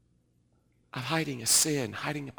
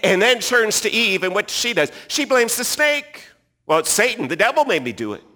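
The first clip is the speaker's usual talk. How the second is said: loud, high preaching voice; full-force preaching; 8 semitones higher, 12 dB louder than usual. Acted. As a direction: preach it out to the whole room.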